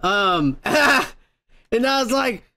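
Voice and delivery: ordinary talk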